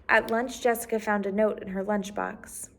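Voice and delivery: usual speaking voice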